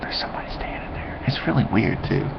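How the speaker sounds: hushed whisper so the stranger won't hear. Real, not acted. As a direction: drop to a secret whisper. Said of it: "notices someone nearby, whispers".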